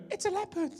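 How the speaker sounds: squeaky high-pitched mock announcement; silly high voice. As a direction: say it way up high, jokey. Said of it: "don't even go".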